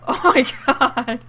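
loud, high, slow words squeezed out through laughter; can barely get the words out for laughing; laughing hard. Real, not acted. Streamer howling at her Sim's horrified face, laughing. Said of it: "I'm sorry I'm laughing".

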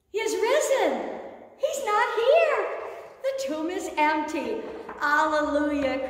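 excited Easter shout; joyful proclamation. Acted. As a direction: announce it with triumph.

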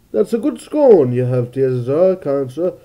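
exaggerated British with jumping pitch; hammed-up British accent. Shown as a demonstration. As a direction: do a mock British gent. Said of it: "how do I do the British accent again?".

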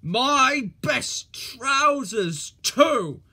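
loud slow outraged wail. A narrator's reading. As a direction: protest in loud dismay. Controller outraged.

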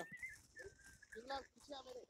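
high, drawn-out call; calling out to teammate. Real, not acted. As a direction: stretch the name out, rising at the end.

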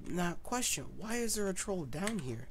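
usual speaking voice

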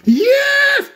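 very high, loud, drawn-out cheer; drawn-out falsetto shout of joy; ecstatic yes. Acted. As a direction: scream yes like he won the lottery. Man's excited reaction.

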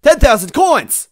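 high, loud, drawn-out cry of triumph; elated shout. A narrator's reading. Narrator reveals the roulette's prize, elated shout. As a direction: shout it out like a jackpot win.

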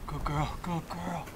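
slow, soft whispered coaxing; hushed encouragement to the labouring cow. Real, not acted.

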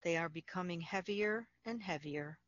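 calm, unhurried meditation voice with long pauses; guided meditation. Acted. Facilitator guiding listeners through Yoga Nidra, calm guided meditation.

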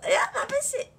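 high, loud startled cry; real surprised exclamation; dazzled. Real, not acted. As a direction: blurt it out, caught off guard. The light is suddenly too bright.

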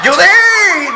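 high laughing shout of her name; urgent shout. Retold or imitated. Husband shouting to his wife while running, laughing.

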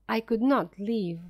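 plain reading voice

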